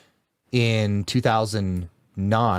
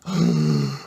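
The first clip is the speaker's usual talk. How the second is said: sharp imitated gasp; hammed-up realization gasp; mock gasp of realization; 6 semitones lower than usual. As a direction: gasp as if struck by the truth. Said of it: "And I was like".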